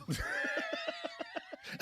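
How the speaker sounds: real high-pitched cackling laugh; big laugh. Real, not acted. Host cracking up at joke about comic's daughter, high laugh.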